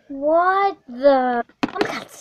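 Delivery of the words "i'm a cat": a secret little whisper. Acted. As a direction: whisper it, softer, as the cat. Whispered cat line.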